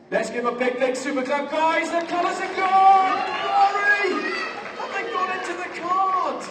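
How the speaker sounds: high, rising announcement; big stage shout, pitched high; excited showman. Real, not acted. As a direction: whip up the audience for applause. Showman announcing the trick worked.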